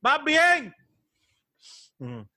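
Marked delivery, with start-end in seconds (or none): 0.0-0.8 slow, high, loud shouted encouragement; aggressive call from afar. Pretend. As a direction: yell it from far away, slow and high. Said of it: "decirle agresivo desde lejos".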